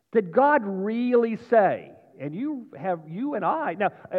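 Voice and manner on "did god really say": loud, higher, drawn-out question; slow, emphatic quoted doubt; raised-voice challenge. Acted. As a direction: challenge them with a raised preacher's voice. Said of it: "doing a little bit of yelling today".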